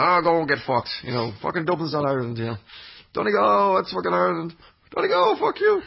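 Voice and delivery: a deliberately bad Irish accent, taking the piss; pathetic Irish accent. Irish blokes ribbing each other in a hammed-up Irish accent. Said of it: "that's my pathetic Irish accent".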